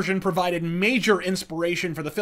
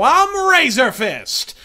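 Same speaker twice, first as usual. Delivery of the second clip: loud slow sign-off; booming slow intro; signature sign-off; 5 semitones higher, 6 dB louder, much slower than usual. Real, not acted. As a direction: announce your name like a title card. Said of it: "signature sign-off".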